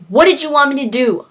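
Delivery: exasperated retort; defensive shout. Retold or imitated. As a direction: throw it back at her fast and loud. Hoffman arguing back with wife.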